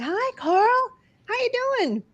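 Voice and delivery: cheerful spirit aunt calling him over; aunt greeting him. Retold or imitated. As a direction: sing out a bright hello as the late aunt. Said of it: "she's like".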